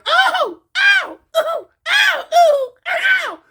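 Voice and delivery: very high squeals of pain; hammed-up high yelps of pain; mock squealing. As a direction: squeal ow like a sissy fight. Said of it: "they hollering".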